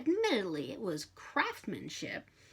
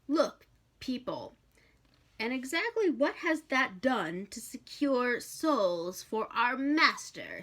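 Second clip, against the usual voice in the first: demon scolding; 5 semitones higher, 4 dB louder, slower than usual. Acted. Hastur scolding Crowley.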